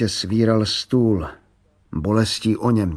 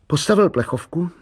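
usual speaking voice

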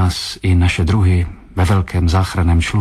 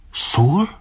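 startled exclamation; 7 semitones higher than usual. A narrator's reading. Narrator questions the captain about the salt, startled exclamation. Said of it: "vykřikl jsem".

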